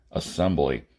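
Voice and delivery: low, loud barked call; barked over the PA. A narrator's reading. The PA calling the crew together, barked loud. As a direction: bark it like a ship's loudspeaker. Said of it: "the P A barked".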